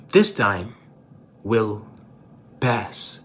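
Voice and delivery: low, word by word with pauses; deliberate, falling away at the end; solemn reveal. Retold or imitated. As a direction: let each word land gravely. Repeating the inscription as a closing lesson.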